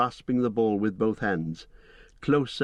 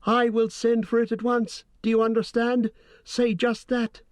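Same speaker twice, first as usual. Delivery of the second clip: very high and loud, toneless; a shrill possessed voice that isn't his own; 13 semitones higher, 4 dB louder than usual. A narrator's reading. Possessed hobbit repeating the dark message, shrill and toneless. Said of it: "he cried in a shrill and toneless voice".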